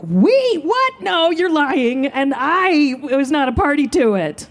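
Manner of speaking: very high, stretched-out protest; hammed-up kid's outraged denial; indignant disbelief. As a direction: squeal in shock, then slow down insisting. Child self protesting to sister.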